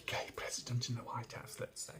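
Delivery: breathy conspiratorial whisper with a giggle; whispered aside. Real, not acted. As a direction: let it slip out as a secret. Cheeky aside correcting himself.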